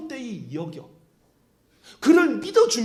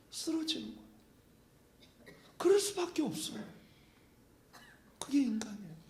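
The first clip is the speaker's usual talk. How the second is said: much softer, whispered; hushed sermon; 4 semitones higher, 9 dB quieter than usual. Real, not acted. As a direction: drop to a sad whisper. Preacher drops to a whisper. (속삭이듯 낮춘 설교)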